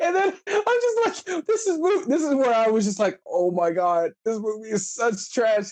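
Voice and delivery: high, disbelieving mock voice; mock exasperation. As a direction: groan at the movie in a high voice.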